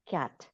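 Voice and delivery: "کیٹ" with lips lightly open, front a; light front vowel. Shown as a demonstration. Teacher modelling fatha for students, light front vowel in "کیٹ". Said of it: "جس طرح بولتے ہیں".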